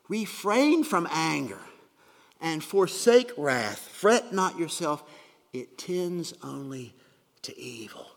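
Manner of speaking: slow, emphatic scripture reading. Acted. Preacher reading Psalm thirty-seven to the congregation, slow and emphatic. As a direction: let each command land slowly.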